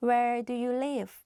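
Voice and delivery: usual speaking voice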